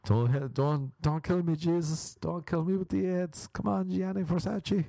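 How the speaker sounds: high-pitched comic begging; mock pleading. Comedian begs not to be killed, high mock pleading. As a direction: plead for your life, voice pushed up high.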